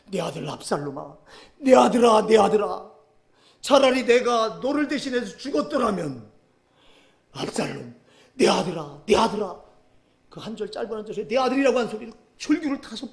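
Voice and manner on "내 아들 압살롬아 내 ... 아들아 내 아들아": a touch of a wailing father; mild lament. Acted. Preacher voicing grieving King David, a mild wailing lament. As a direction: cry out for the lost son, louder. David mourning his son.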